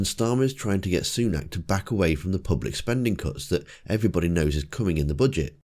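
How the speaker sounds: ordinary talk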